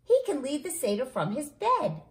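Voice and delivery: put-on keen child with a plan; excited. A narrator's reading. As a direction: announce your solution eagerly. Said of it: "I say excitedly".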